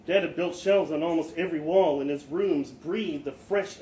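ordinary delivery

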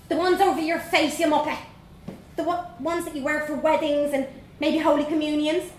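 put-on high, bold Dublin girl voice; young Dublin girl. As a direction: play a cheeky little Dublin girl. Vanessa explains scornfully.

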